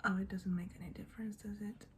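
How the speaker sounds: ordinary talk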